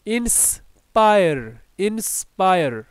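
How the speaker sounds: slow, split "ইন্স-পায়ার", said twice; teacher modelling "ইন্সপায়ার", slowly with a falling end; pronunciation drill. Shown as a demonstration. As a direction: stretch "ইন্সপায়ার" in two clear parts. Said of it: "উচ্চারণগুলো জেনে নেই".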